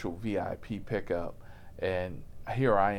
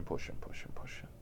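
softer and softer to a whisper; fading off; 11 dB quieter than usual. Acted. The driver describes the passenger's pressure, fading to a whisper. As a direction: let it die away. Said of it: "fading repetition".

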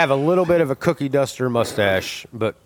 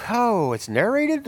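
mock surprise; 9 semitones higher, slower than usual. Reaction to trailer narration.

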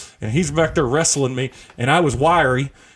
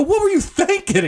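much higher, incredulous demand. Retold or imitated. Husband turning to his wife after the punch, high incredulous. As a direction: ask it in high disbelief. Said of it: "And I turned and go".

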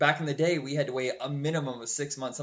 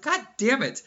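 much higher, louder exasperated curse; retold frustration; 10 semitones higher, 6 dB louder than usual. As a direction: blurt it out in mock fury. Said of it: "I was like".

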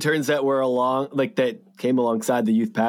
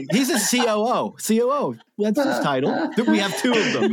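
laughing; 10 semitones higher than usual. Real, not acted. Laughing while explaining title.

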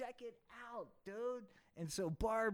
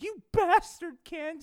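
higher, louder shrieked insult; Barbie screaming; 8 semitones higher, 10 dB louder than usual. Acted. Barbie yells at Ken, mock shriek. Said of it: "said Barbie".

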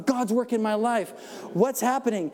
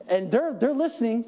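ordinary delivery

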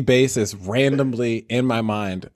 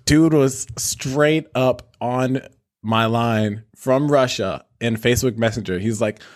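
ordinary talk, nothing marked

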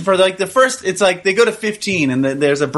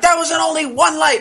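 mock yelling mom; 10 semitones higher than usual. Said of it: "she's like".

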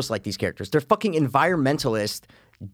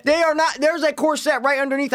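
high-pitched, worked-up rebuttal; heated insistence; 14 semitones higher than usual. Real, not acted. Heated dispute over a film scene.

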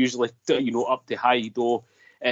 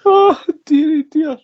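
very high and loud, crying with laughter; laughing fit; 7 dB louder, slower than usual. Real, not acted. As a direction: gasp it out between laughs.

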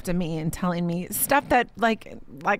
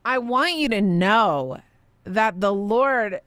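ordinary delivery

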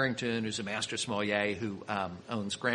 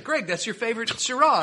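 high, loud mock disbelief; retold incredulous protest; acted astonished protest; 11 semitones higher, 6 dB louder than usual. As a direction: object in amazement, voice pitched way up.

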